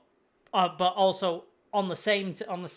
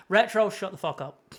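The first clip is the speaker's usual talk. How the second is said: ordinary delivery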